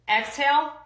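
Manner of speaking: strong steady demo exhale; loud hiss exhale. Shown as a demonstration. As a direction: push the breath out with a hiss. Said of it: "it's gonna sound like this".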